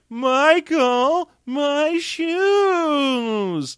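hammed-up helpless heroine whine; imitating the damsel whining to Michael about her shoes; whiny damsel. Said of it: "Willie Scott trope for how Debbie Monahan should act".

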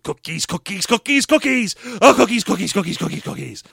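manic cookie-obsessed chant; Teddy. Retold or imitated. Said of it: "Teddy is saying".

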